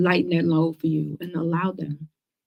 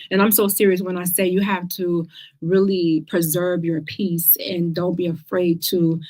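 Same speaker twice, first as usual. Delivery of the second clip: ordinary talk, nothing marked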